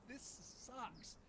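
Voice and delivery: mock exasperated whine; retold griping. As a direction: moan about the long walk, half in fun. Retold grumble while walking in dark.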